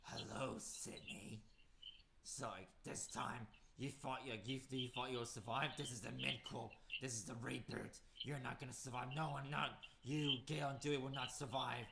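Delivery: low, slow, whispery threat; menacing killer voice. Acted. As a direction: creep her out on the phone. Ghostface threatening call.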